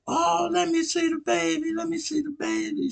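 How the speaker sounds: put-on sweet-talking girl. Feisty girl asking to hold a neighbour's baby, high and sweet. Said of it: "she came like".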